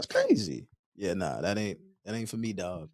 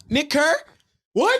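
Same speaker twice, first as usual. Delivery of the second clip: calling a name out; 4 dB louder, slower than usual. Pretend. Acting out name call at restaurant.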